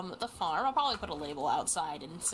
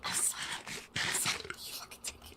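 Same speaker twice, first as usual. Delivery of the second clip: whisper; 5 dB quieter, much slower than usual. Real, not acted. Accusing friend of stealing items.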